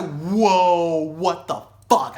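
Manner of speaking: loud, drawn-out disbelief; shocked outburst. Retold or imitated. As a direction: retell a stunned reaction, stretched out loud. Shocked at Nazi symbols.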